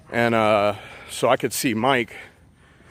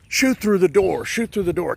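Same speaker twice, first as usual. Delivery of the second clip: retold shout; 7 semitones higher than usual. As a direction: bark it out like a panicked gunman, pitched up high. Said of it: "the guy starts going".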